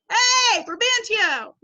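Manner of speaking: loud, high casual holler; calling out. Acted. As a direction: yell it to get someone's attention. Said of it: "That's like saying".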